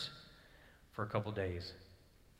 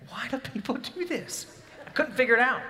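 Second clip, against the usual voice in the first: exasperated; 13 semitones higher, 6 dB louder than usual. Retold or imitated. Exasperated retelling of shock.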